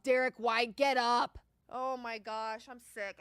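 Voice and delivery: ordinary talk, nothing marked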